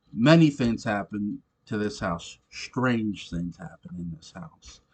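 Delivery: a touch of ghost-story menace; mild spooky hint. Retold or imitated. Quoting David's ghostly hint.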